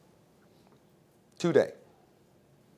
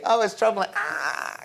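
much higher, hammed-up fretting; mock distress; 9 semitones higher than usual. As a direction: play the overwrought worrier for laughs. Mocking the fragile believer.

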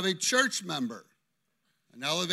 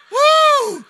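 very high, loud whoop; 16 dB louder than usual. Real, not acted. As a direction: let out a startled whoop. Reacts to rude gesture.

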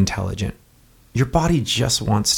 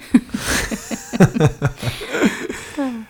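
long burst of real laughter; laughing; 14 semitones higher than usual. Real, not acted. Co-host reacting to the joke, real extended laughter. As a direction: laugh it out, higher than speech.

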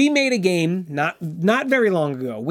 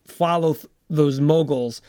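usual speaking voice

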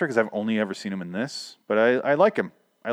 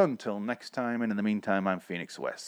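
ordinary talk

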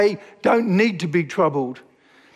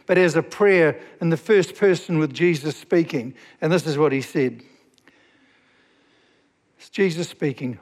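usual speaking voice

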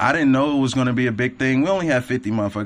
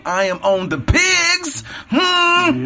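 hyped pig show intro; 3 dB louder, much slower than usual. Acted. Podcast intro, pig show catchphrase.

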